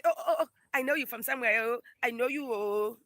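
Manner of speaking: loud, high mock cries of recognition; imitated excited recognition. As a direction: mimic strangers calling out excitedly. Acts out strangers recognising her.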